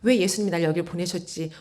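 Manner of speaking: normal talking voice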